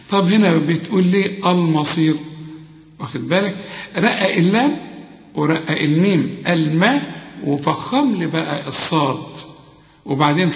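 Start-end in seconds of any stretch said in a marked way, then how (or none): none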